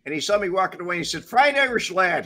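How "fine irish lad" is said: Irish brogue. Retold or imitated. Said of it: "He talked with a brogue".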